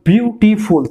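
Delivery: বিউটিফুল drawn out in three slow beats; বিউটিফুল spelled out by ear, one syllable at a time. Shown as a demonstration. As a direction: slowly split বিউটিফুল as beau-ti-ful. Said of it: "তিনটে syllable উচ্চারণ করছো".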